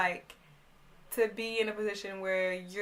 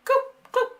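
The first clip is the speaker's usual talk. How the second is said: two high, loud clicking noises; sharp high "coop, coop" sound effect; imitated handcuff clicks; 8 dB louder than usual. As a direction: mimic cuffs snapping shut.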